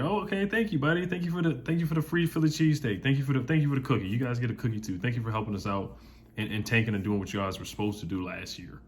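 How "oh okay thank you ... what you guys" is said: oh-so-grateful, dripping with sarcasm; sarcastic gratitude. Acted. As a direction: gush fake thanks at the rival fans. Sarcastic thanks to rival fans.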